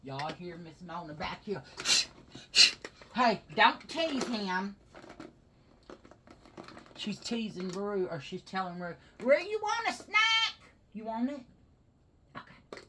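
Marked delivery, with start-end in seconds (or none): 3.1-4.8 raised, higher voice telling off a pet; slow, loud reprimand to the dog; real scolding call. Real, not acted. Scolding a dog mid-video. 9.2-10.5 very high, loud voice for a dog; high-pitched, coaxing pet-owner call; excited pet talk. Real, not acted. Offering the dog a snack. 10.9-11.4 high voice for a dog; lifted, coaxing pitch; coaxing pet talk. Real, not acted. Offering the dog a snack.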